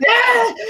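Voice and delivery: short high-pitched playful scream; sudden silly yelp to fill an awkward silence; random scream. Retold or imitated. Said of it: "Or scream".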